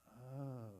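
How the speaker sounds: very soft, hushed "oh"; stunned realisation. Retold or imitated. Soldier grasping what the sergeant meant, hushed. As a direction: breathe it out as it sinks in.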